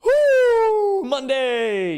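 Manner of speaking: loud, high whoop of joy; excited cheer. Real, not acted. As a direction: shout it out in triumph. Cheering after a win.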